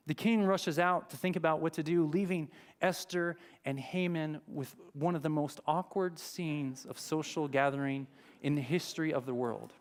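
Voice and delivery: usual speaking voice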